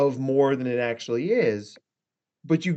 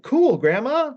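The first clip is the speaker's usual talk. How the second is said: higher, breezy and clueless; breezy oblivious reply; 10 semitones higher, 3 dB louder, slower than usual. Retold or imitated. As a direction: toss off a carefree "Cool, Grandma". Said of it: "I'm like".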